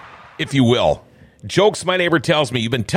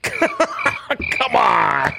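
laughing; 8 semitones higher than usual. Real, not acted. Laughing after his own joke.

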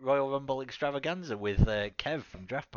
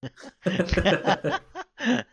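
loud high burst of laughter; cracking up hard at the joke; big laugh; 10 semitones higher, 6 dB louder than usual. Real, not acted. Friend laughing at a podcast gag, loud high laugh.

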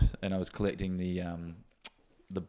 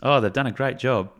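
upbeat scripted sponsor ad; ad read; 4 semitones higher, 4 dB louder than usual. Acted. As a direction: sell it like a radio spot.

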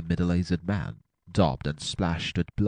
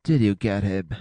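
mildly eager asking; eager question; 3 semitones higher, 4 dB louder than usual. A narrator's reading. Wounded man asking as the doctor steps inside, mildly eager. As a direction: ask with a touch of eagerness. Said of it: "asked a voice eagerly".

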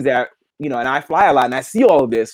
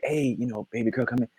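1 soft whisper; 4 semitones lower, 11 dB quieter than usual. Retold or imitated. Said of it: "they be like".

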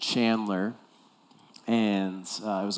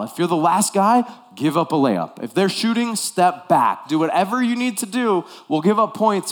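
loud, higher urgent orders; stern coach; 6 semitones higher, 8 dB louder than usual. Retold or imitated. Coach ordering his player mid-game, loud and urgent. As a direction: bark the rules at him.

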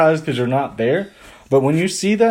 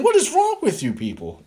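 much higher, exasperated disbelief; baffled inner outburst; 9 semitones higher than usual. Retold or imitated. Teen baffled by happy poor family, high exasperated disbelief. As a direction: throw up your hands at them. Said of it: "And I'm thinking".

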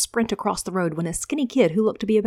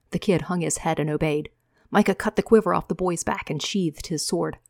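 usual speaking voice

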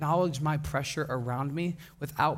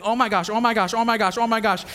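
louder, rapid string of panicked exclamations; mock panicked scream; 5 semitones higher, 5 dB louder than usual. Teen driver freaking out behind the wheel, mock screaming. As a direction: imitate a friend freaking out at the wheel. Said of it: "she just starts screaming".

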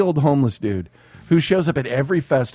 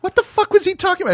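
a much higher, fast baffled quote; high mock baffled; faster than usual. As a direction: repeat her bafflement up high. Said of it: "That was her exact words".

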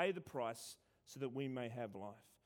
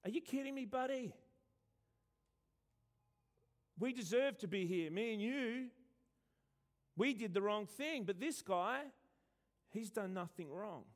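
higher, incredulous telling-off; indignant rebuke; 5 semitones higher than usual. Retold or imitated. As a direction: scold the other criminal in disbelief. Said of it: "has a go at that other guy".

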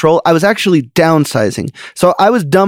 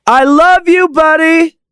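much higher, sing-song I love you called out across the mall; teasing high-pitched I love you to embarrass a shy kid; escalating declaration; 13 semitones higher, slower than usual. Retold or imitated. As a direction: call it out high so the whole mall hears. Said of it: "I'm just gonna get louder and louder".

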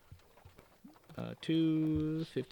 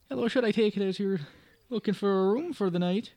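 higher Irish landlady voice; full Irish; 6 semitones higher, 4 dB louder than usual. Acted. Landlady greeting a traveller at the door, Irish lilt. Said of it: "let me go full Irish".

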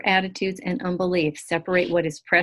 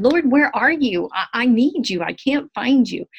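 higher, louder, desperate plea; a lightly voiced anxious plea, higher and louder; pleading prayer; 6 semitones higher, 5 dB louder than usual. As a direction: call out to a God who seems gone. Imitating her own desperate prayer.

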